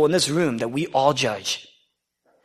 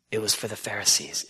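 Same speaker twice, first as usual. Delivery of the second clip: usual speaking voice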